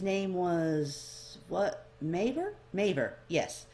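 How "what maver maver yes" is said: ordinary delivery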